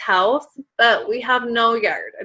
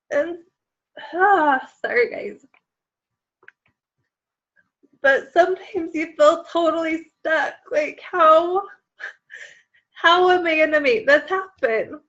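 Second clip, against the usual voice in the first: crying; 5 semitones higher than usual. Real, not acted. Mom telling her team about her family's dream, choking up and tearful. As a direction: voice breaking and higher as tears come. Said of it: "I hope I don't get too emotional".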